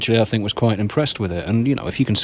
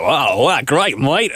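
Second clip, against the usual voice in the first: mock Crocodile Dundee Aussie; 4 semitones higher, much slower than usual. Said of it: "turn into Crocodile Dundee".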